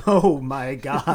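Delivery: shocked laughing gasp. Real, not acted. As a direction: laugh it out slowly. Reacting to dark joke.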